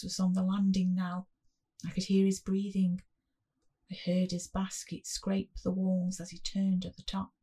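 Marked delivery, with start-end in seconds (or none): none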